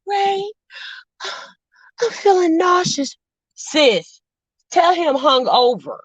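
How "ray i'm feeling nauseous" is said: higher, mock-sickly plea with a sigh; mock pregnant wife. Host mocking Karen's fake pregnancy, higher whiny plea. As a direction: ham up a queasy wife fishing for sympathy. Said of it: "she was acting like she was pregnant".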